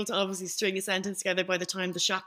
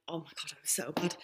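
embarrassed whisper; 3 semitones lower, 8 dB quieter than usual. Real, not acted. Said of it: "embarrassed aside".

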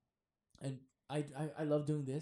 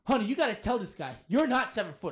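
louder, higher mock arguing; imitating indignant fan; 5 semitones higher, 9 dB louder than usual. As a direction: act out a husband protesting to his wife. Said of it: "This one dude came up with his wife".

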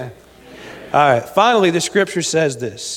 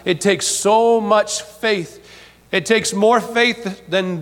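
slow emphasis; 4 semitones higher, much slower than usual. Acted. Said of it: "preacher slows for emphasis".

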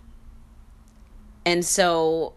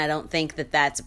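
usual speaking voice

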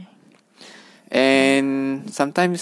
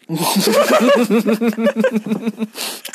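hearty laugh; 10 semitones higher than usual. Real, not acted. Laughing at partner's rehearsed answer.

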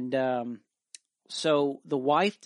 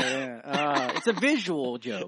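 laughing explanation; 4 semitones higher, 6 dB louder than usual. Real, not acted. Explains joke while laughing.

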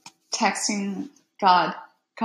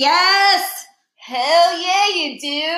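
loud, high cheer; 7 semitones higher, 5 dB louder, much slower than usual. Real, not acted. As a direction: cheer at the screen. Cheering at the screen.

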